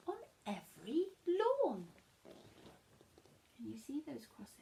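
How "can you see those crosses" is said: whispered question. Real, not acted. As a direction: whisper it like a secret. Aside to listeners.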